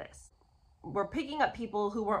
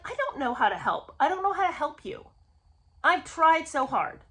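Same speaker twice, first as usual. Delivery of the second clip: raised pitch, pleading mock despair; exasperated helplessness; 7 semitones higher, 5 dB louder than usual. Host answers confused commenters, exasperated and helpless. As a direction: throw up your hands at hopeless viewers, pitched higher. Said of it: "I'm just like".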